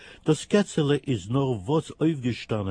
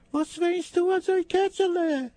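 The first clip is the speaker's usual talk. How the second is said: very high little hen voice. Acted. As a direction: cluck the question like a hen. Hen asks kitten.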